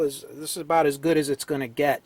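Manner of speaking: normal talking voice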